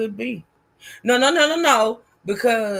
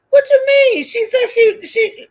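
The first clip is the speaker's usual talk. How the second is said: high, loud incredulous challenge; loud disbelief; 9 dB louder than usual. Real, not acted. Host challenges a doubting viewer, loud disbelief. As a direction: burst out, stumbling over the words in disbelief.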